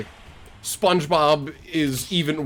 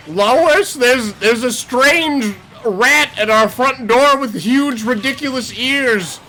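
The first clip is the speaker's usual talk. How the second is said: raised, drawn-out cartoon husband voice; Peter Griffin; 9 semitones higher, 5 dB louder, slower than usual. Cartoon husband reporting a rat to his wife, high drawn-out call. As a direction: call out slowly in cartoon alarm. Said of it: "And it's like".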